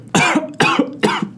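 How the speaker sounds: high rough coughs from the spice; harsh coughing fit. Real, not acted. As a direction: cough hard as the heat hits. Choking on spicy food.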